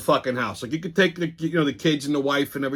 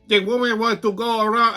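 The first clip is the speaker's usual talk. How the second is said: higher, sheepish put-on voice; mock excuse; 9 semitones higher than usual. Kid who lifted the tailgate makes a feeble excuse to the teller, higher and sheepish. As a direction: mimic the kid making a lame excuse. Spanish guy excusing himself.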